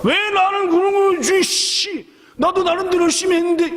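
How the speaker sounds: high, whiny sulking complaint, a bit fast; a jealous disciple grumbling that his hard work went unnoticed, in a high whiny voice; sulky disciple. Retold or imitated. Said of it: "제자 하나가 삐져갖고".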